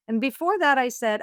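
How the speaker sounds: usual speaking voice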